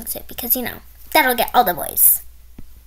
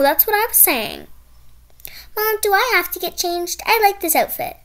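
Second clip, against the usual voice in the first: ordinary delivery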